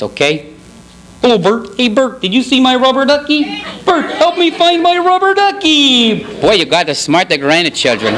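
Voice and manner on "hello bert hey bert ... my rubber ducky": put-on high, childlike puppet voice; hammed-up children's show puppet; puppet character impression. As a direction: call to a pal, climbing higher to a plaintive plea. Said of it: "I'm gonna do the impression".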